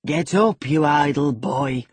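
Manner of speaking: loud, falsely jovial order to get up; forced hearty exclamation. A narrator's reading. Heathcliff to his idle son, loud forced heartiness. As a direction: bark it out with put-on cheer. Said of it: "he exclaimed with assumed heartiness".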